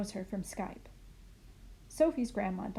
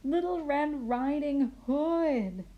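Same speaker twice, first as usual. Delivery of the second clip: slow, louder, higher chant; calling out together; 6 semitones higher, 4 dB louder, much slower than usual. A narrator's reading. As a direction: call it out together, laughing. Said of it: "they call out together".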